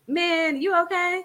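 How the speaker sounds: mock-concerned man asking; concerned buddy. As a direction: ask it like a confused homeboy. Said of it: "it's not even just females".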